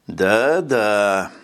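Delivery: put-on creaky grating old man voice; unpleasant creaky voice. A narrator's reading. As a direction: grate it out, low and creaky. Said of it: "неприятным скрипучим голосом".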